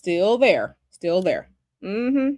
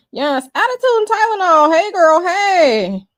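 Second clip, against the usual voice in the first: 1 excited greeting; 8 semitones higher, 8 dB louder than usual. Real, not acted.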